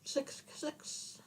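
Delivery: slow sinister whisper; creepy whisper. Acted. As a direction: whisper it like a demon chant. Spooky demon joke.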